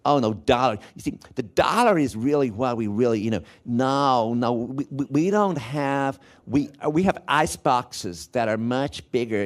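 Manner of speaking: slow, mock American twang; put-on American accent by an Irish speaker. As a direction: stretch it out like a returned Yank's American. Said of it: "using an American twang".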